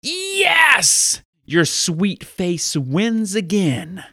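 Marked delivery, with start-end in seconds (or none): 0.0-1.2 put-on high, elated kid cheer; triumphant child. Child whose mom finally relents, triumphant. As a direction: celebrate with a high squeal of yes.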